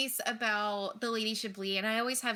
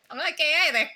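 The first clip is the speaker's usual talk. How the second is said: playful Chablis impression; 6 semitones higher, 6 dB louder, much faster than usual. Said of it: "repeats the quote in a put-on voice".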